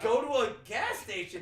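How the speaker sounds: loud, high, incredulous answer; mock exasperation. Telling a Boston guy where to get ice, exasperated. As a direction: blurt out the obvious, exasperated.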